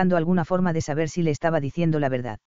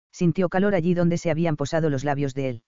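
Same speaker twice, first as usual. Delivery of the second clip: plain reading voice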